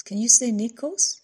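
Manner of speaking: put-on high baby-coaxing voice. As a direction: draw the baby in with a rising ask.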